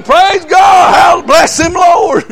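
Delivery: loud joyful shout of praise; high, loud church shouting; congregation shouting. Retold or imitated. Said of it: "They shouted too".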